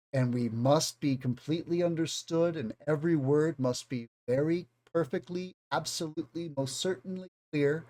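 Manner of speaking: mock a teacher who spells out every word; over-careful teacher speech. Shown as a demonstration. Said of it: "English like this".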